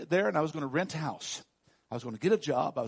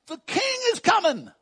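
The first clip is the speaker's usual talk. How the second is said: excited proclamation; 10 dB louder than usual. Retold or imitated. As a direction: proclaim it like a herald, high and loud. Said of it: "They were just excited. They're there to proclaim".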